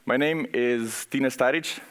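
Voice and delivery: ordinary talk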